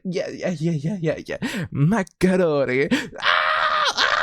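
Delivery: high excited yelling, macaroni shouted; excited cheering. Retold or imitated. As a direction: cheer it out like a hyped crowd. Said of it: "I was like".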